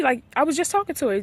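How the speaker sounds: normal talking voice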